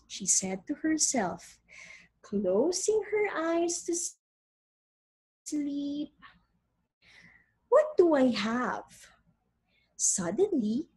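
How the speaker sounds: expressive storybook reading; story narration. Acted. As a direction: read it like a picture book. Reads story aloud to class.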